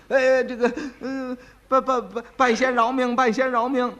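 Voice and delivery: frightened, stammering plea for his life; begging for mercy. Acted. (吓得结巴地跪地求饶)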